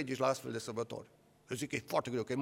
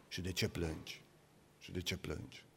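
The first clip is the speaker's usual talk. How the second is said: low, soft, tender question; gentle voice of the Lord; 9 semitones lower, 11 dB quieter than usual. Acted. The Lord asks weeping Mary why she cries, tenderly. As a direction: ask as if comforting someone weeping.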